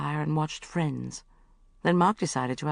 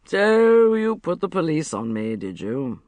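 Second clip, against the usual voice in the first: put-on slow, slurred drunk accusation, a little louder; drunken slur; 6 dB louder, slower than usual. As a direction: drag the words like a drunk man. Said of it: "he slurred".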